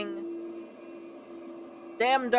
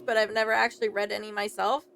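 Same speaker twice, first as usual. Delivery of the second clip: ordinary talk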